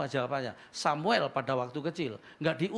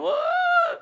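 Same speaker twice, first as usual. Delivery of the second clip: very high imitated howl; mock child wail; 12 dB louder than usual. Preacher voices the boy crying harder, high wail.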